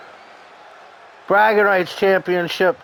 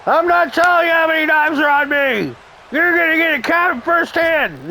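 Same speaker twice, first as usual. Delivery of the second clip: high, gruff hard-man threat; tough guy threat voice; 11 semitones higher than usual. Acted. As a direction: growl it like a bar brawler. Voicing fighter's threat.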